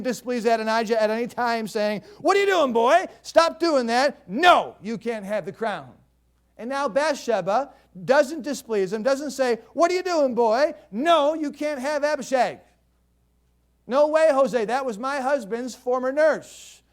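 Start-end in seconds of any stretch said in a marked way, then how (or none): 2.2-5.9 put-on loud, high scolding of a boy; stern scolding. 9.7-16.8 put-on loud, high scolding of a boy; stern scolding.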